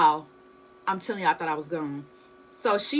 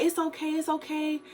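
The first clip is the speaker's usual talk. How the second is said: reassuring cousin; 7 semitones higher than usual. Retold or imitated. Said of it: "she was like".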